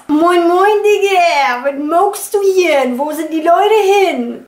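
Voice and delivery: high, loud imitation of the Hamburg accent. Shown as a demonstration. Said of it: "That is hilarious". (Hamburger Akzent, höher und lauter nachgemacht)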